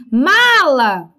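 "Mala" called out with a long, strong MA; "MAAla", first syllable hit and held; stress on MA. Shown as a demonstration. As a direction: call "Mala" as if summoning it, stretching MA. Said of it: "o ma é mais forte".

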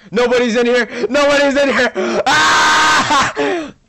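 high, loud frantic yelling; panicking gamer. Real, not acted. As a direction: scream orders in a sudden panic. Player jumps out, chaos.

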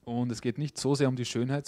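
ordinary delivery

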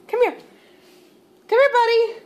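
high, loud sing-out to a pet; calling the dog. Real, not acted. Owner calling her stuck dog over, high-pitched coaxing.

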